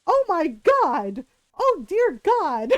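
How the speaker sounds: slow, high horrified shrieking; mock librarian shriek. Librarian shrieking at kids caught in cubicle, hammed-up horror. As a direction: shriek like a scandalized old librarian. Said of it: "librarians going".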